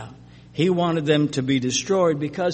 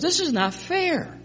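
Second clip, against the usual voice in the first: much higher, petulant protest; whiny complaint; 12 semitones higher, 3 dB quieter than usual. Acted. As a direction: whine it like a sulking child. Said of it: "to put it in other words".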